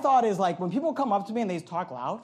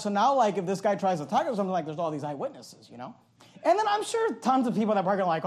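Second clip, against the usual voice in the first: ordinary delivery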